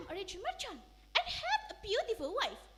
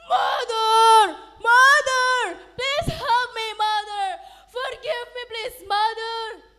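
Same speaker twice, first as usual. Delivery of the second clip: much louder desperate shout; crying loudly; 4 semitones higher, 16 dB louder, slower than usual. Acted. Son in the storm begging mother, desperate shout. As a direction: scream for forgiveness. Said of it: "Mine cried loudly".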